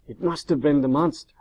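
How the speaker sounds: an understated yell; mild yell. A narrator's reading. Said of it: "Frankenstein yelled".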